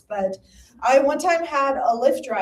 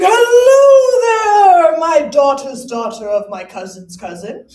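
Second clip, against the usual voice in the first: high, loud, slow ghostly greeting; hammed-up ghost of Benjamin Franklin; 6 semitones higher, 12 dB louder, slower than usual. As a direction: boom out like an old ghost. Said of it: "hear the ghost of Benjamin Franklin".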